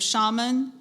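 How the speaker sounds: "shaman" as SHAH-mun, first vowel as in "father"; first alternate pronunciation. Shown as a demonstration. Pronouncer offers the speller alternate pronunciations, "shaman" as SHAH-mun. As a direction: stress the first syllable of "shaman", broad "ah". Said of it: "a couple alternate pronunciations".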